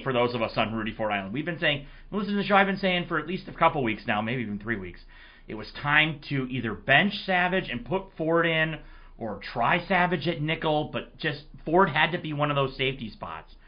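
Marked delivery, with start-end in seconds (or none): none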